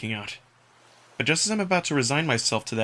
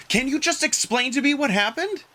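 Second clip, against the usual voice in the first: higher, weary demand; exasperated; 13 semitones higher than usual. Acted. Margot demanding an explanation, exasperated. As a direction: patience running thin.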